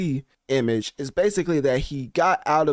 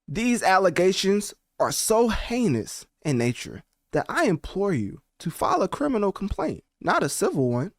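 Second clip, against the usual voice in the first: villainous statement reading; 6 semitones higher than usual. Acted. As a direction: read it like a supervillain's decree. Reads [name] statement dramatically.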